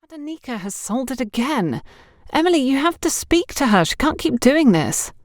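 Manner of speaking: voiced as upset, pleading a little; distressed. A narrator's reading. Ruth complaining to Emily, mildly distressed. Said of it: "A very distressed Ruth complained".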